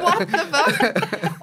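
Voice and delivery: slow, laughing what-the; amused stunned drawl; laughing disbelief. Real, not acted. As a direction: draw it out through a laugh. Amazed at Derry crowd abroad.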